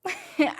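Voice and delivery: quick, high chuckle; short real laugh. Real, not acted. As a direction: chuckle at your own remark. Laughs at her own remark.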